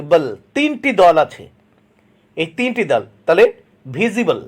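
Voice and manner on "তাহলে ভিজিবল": ordinary talk, nothing marked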